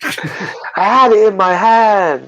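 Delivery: high, slow, far-off cry of loss; hammed-up despair over a dropped prize; mock anguished wail. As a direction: wail it like you just lost everything.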